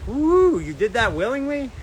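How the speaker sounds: high incredulous whoop then a teasing question; high mock-shocked woo, then teasing; mock disbelief. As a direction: squeal like you can't believe someone moved north on purpose. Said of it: "and I'm like".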